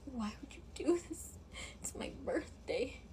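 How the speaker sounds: tearful whispered plea; crying whisper. Retold or imitated. Girlfriend on her birthday, crying at her boyfriend. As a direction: plead through tears, barely voiced. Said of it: "I'm just sitting here crying".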